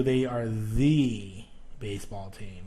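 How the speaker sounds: drawn-out "the" said thee, then "baseball team" drops away; stress on THE. Shown as a demonstration. Teacher showing where emphasis goes, stressed THE as thee. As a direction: hold "the" long, rhyming with see. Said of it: "So you enunciate the".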